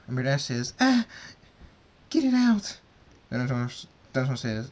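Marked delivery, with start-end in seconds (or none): 0.8-2.8 high, loud cry of pain; pained high shriek; pained shout. Acted. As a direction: shriek it like it hurts. Rainbow Dash crying out in pain.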